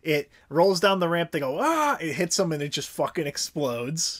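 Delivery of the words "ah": mock scream. Henchmen hit by barrel.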